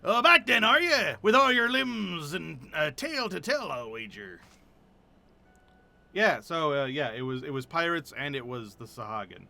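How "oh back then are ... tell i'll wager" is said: put-on hammy pirate accent, louder and hearty; a bluff sea-captain accent, laid on thick; rough pirate accent, boisterous; terrible pirate accent. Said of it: "if you like this terrible accent".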